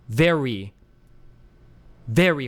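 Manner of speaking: VERY with a hard, falling first syllable; heavily stressed "very"; extreme English accent. Shown as a demonstration. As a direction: punch the VE in very. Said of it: "accents in English are extreme".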